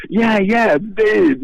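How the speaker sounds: high, eager yeah-yeah of a guy stuck outside; Cheech pleading. Retold or imitated. Cheech outside the locked door, high eager insisting. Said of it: "I could hear his voice going".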